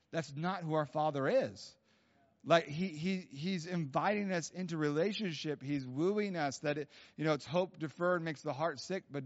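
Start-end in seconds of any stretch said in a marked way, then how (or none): none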